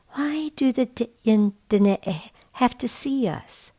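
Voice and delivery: put-on curious child asking; kid voice. A narrator's reading. Kids voiced higher in story.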